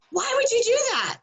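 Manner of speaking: high, stretched mock indignant mother; indignant mom. Mother questioning coach's budgeting lesson, indignant. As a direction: play the outraged overprotective mom. Mom objects in retold call.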